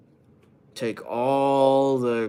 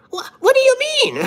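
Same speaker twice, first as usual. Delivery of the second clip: squeaky; 6 dB louder than usual. Pretend. Mock squeaky reaction.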